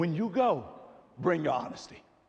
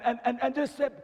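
ordinary talk, nothing marked

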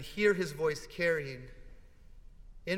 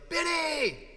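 high, loud shout of a name; big delighted call, pitch falling at the end; joyful yell; 13 semitones higher, 6 dB louder than usual. Retold or imitated. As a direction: holler for your son with joy. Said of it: "He yells".

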